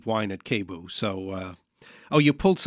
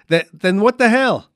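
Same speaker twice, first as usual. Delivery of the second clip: louder, higher exasperated question; baffled outburst; 7 semitones higher, 6 dB louder than usual. Pretend. Host failing to read a mime's gesture, exasperated outburst. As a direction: burst out in bafflement.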